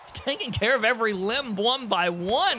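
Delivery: lively play-by-play call; wrestling commentator. Acted. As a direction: call the match like a TV announcer. Calls the wrestling match.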